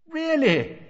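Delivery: high, delighted question; excited. A narrator's reading. Mister Weasley hearing of electric fires, excited and high. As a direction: leap up in pitch with eager interest. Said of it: "said Mister Weasley's voice excitedly".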